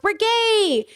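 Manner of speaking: a hammed-up gushing Democrat; mock Democrat cheer. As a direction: chirp it like a cheerleader. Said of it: "Democrats are like".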